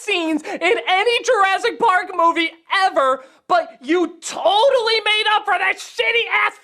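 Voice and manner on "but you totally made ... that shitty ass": ordinary delivery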